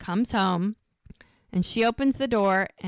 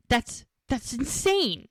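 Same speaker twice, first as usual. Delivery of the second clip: slow, higher, drawn-out disbelief; stunned disbelief; 4 semitones higher, slower than usual. Retold or imitated. Friend hears about custody lawyer, slow stunned disbelief. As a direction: let it sink in slowly, aghast. Mel stunned by shocking news.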